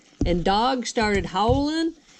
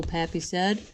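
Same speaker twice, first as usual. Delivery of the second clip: usual speaking voice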